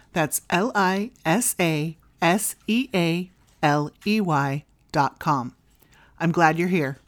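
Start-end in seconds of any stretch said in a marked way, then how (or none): 0.5-5.6 letter by letter, slowly; spelling out slowly. Shown as a demonstration. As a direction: spell each letter out clearly. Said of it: "You can find my website at".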